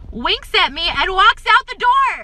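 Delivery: loud, high exasperated holler; outraged outburst. Real, not acted. Teller outraged at the flirting customer, loud outburst. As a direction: build to a high, incredulous shout.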